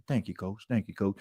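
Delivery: put-on meek young player thanking the coach; timid player. Player thanking his coach, quiet and low.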